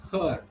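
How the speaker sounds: "Her" as a long vowel without R; silent R. Shown as a demonstration. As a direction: drop the R in "Her". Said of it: "R sound is not pronounced at all".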